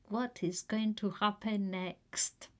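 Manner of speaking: unvoiced, secretive question; whispering. Real, not acted. Narrator whispers to viewers what comes next. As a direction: lean in and whisper the cliffhanger.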